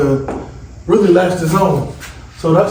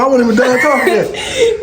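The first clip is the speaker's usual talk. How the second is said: high, laughing complaint; 9 semitones higher than usual. Real, not acted. Laughing at his daughter for cutting the video off. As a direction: laugh through the protest.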